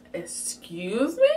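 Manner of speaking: slow, drawn-out rising disbelief; taken aback. Retold or imitated. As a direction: voice an affronted dad, stretching it upward. Dad's shocked reply to Patois.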